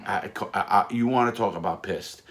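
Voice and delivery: ordinary delivery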